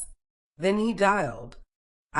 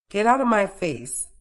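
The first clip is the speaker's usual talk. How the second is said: shaky, louder and higher, angry but trembling; shaking voice; 5 semitones higher, 5 dB louder than usual. A narrator's reading. Mother to son's girlfriend, shaking with anger. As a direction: hold the anger while the voice shakes. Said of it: "voice shaking".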